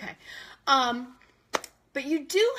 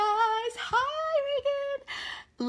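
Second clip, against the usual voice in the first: high hi; excited greeting; much slower than usual. Real, not acted. Host greeting Reagan, high hi. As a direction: chirp a happy hello.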